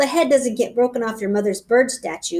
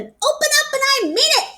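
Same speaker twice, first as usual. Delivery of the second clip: yelling; 14 semitones higher than usual. A narrator's reading. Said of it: "I yelled".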